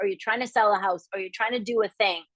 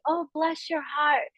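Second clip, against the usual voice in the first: lightly Southern, sweet; 6 semitones higher, slower than usual. Retold or imitated. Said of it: "They'd say".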